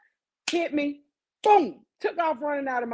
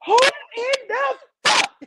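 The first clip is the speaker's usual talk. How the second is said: furious grandmother; 13 semitones higher, 6 dB louder than usual. Retold or imitated. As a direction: roar it like a shocked grandma. Grandmother discovers hole in wall.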